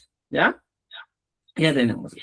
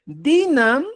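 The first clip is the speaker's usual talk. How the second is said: Dinam raised high with stress; emphatic validator; 7 semitones higher than usual. Shown as a demonstration. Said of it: "Yo puedo validar y decir".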